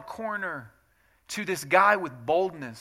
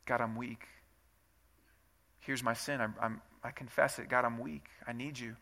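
quiet confession; 7 semitones lower, 9 dB quieter than usual. Shown as a demonstration. Modelled prayer confessing weakness.

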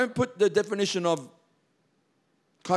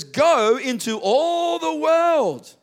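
slow, booming and higher proclamation; proclaiming Jesus's command; 8 semitones higher, 6 dB louder, much slower than usual. Acted. Preacher booming Jesus's command to his disciples, slow and loud. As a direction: ring it out like a great commission. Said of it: "He says".

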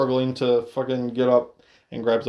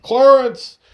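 high, loud shouted name; calling Clarence; 10 semitones higher, 9 dB louder than usual. Retold or imitated. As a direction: call him out across the yard. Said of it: "RoboCop's like".

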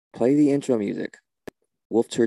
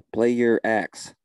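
slow coaxing; much slower than usual. Real, not acted. Coaxing the frozen shaman to play.

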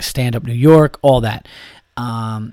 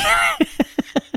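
high burst of laughter; 3 dB quieter than usual. Real, not acted. Cracks up.

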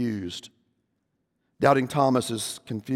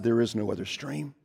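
low, soft, final statement; quiet, unbending lion voice; soft stern lion; 3 semitones lower, 7 dB quieter than usual. A narrator's reading. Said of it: "Aslan said".

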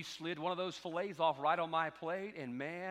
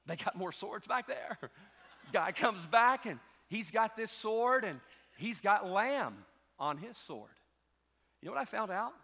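ordinary talk

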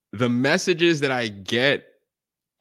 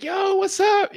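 very high, eager yo; high thrilled hello; excited fan greeting; 5 dB louder, slower than usual. Retold or imitated. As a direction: call out like a fan who spots you, pitched way up. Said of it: "they're like".

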